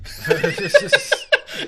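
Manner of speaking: loud high laugh bursting out; high laughter. Real, not acted. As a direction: laugh hard at the punchline. Laughing at comic's joke.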